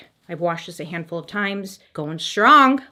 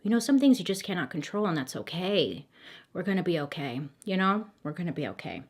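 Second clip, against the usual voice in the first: soft, hushed reassurance, much softer; calm the viewer down gently and quietly; soothing calm; 2 semitones lower, 9 dB quieter than usual. Acted. Host soothing worried viewers before the intro, soft and hushed.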